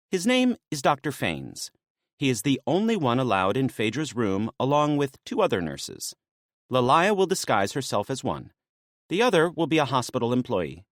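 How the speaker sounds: lightly voiced woman, brisk and businesslike; a hint of a maenad laying out the plan; briefing maenad. Naya briefing Dionysus on the rescue plan, lightly voiced.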